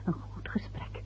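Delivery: whispered prompt. Acted. Wife whispering to her husband what to say on the call. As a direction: hiss the line to him on the phone.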